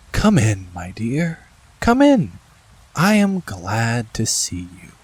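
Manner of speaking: a lightly voiced sweet old lady, gentle and slow at the end; a hint of a kindly old woman welcoming a child in; sweet old shaky voice. A narrator's reading. Old lady welcoming the princess, lightly voiced sweet old voice. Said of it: "in a sweet, but old and rather shaky voice".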